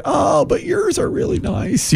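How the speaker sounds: high, soft, drawn-out reassurance; slow, high-pitched fake niceness. Telling a tattooed friend his are nice, politely fibbing.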